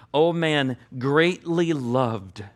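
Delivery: very slow, tender reading; slowly savored scripture; slow reverent quote. Acted. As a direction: stretch each word out with reverence. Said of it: "I repeat it again in Daniel".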